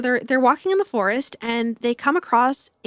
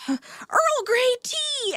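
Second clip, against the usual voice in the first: mock shout; 11 semitones higher, much slower than usual. Second girl calling her drink from the slide, high mock shout. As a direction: call it out high and slow. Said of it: "and she shouts".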